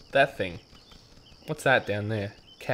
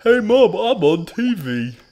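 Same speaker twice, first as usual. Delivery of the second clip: loud, slow, higher goofy villager voice; Noel voice; 6 semitones higher, 8 dB louder, slower than usual. Retold or imitated. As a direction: shout it slowly like a starstruck villager. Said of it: "That's my Noel impression".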